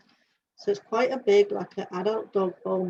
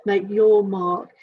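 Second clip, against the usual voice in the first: slow and louder, leaning on each word; slow emphatic message; 6 dB louder, much slower than usual. Retold or imitated. Passing the message to Claire, slow and emphatic. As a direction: deliver it to Claire like a motto. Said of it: "So this is for Claire".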